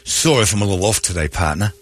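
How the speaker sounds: low, sleepy put-on voice; low mock boast; bragging female coworker. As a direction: drop low and toss it off between pauses. Acting woman bragging at work.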